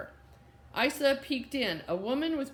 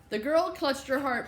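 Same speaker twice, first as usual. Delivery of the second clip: ordinary delivery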